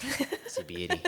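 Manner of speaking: high, laughing Australian croc-hunter voice; Steve Irwin. Retold or imitated. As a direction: marvel at the beast in a high Australian twang, giggling. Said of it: "Steve Irwin".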